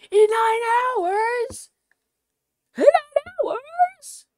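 in nine hours, shrieked very high and loud; shocked squeal. Real, not acted. Streamer to chat, aghast at the wait, shrieking.

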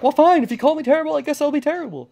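high, fast huffy villain; defiant villain voice. Retold or imitated. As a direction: give in with a pouty huff. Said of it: "she's like".

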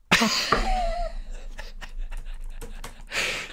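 a helpless, squeaky high giggle; high giggling laugh. Real, not acted. Streamer giggling at chat's name idea, high laugh.

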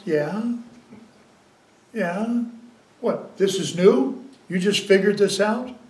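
ordinary talk

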